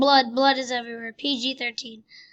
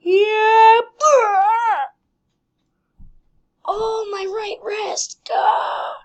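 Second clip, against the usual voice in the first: loud high yelps of fake agony; hammed-up injury cry; mock pain; 7 semitones higher, 9 dB louder, much slower than usual. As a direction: wail as if the wrist broke.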